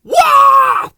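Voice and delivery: loud high shriek of fright; sudden piercing "wah"; startled yell. A narrator's reading. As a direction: cry out as if jolted awake. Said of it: "having a snooze inside his shell".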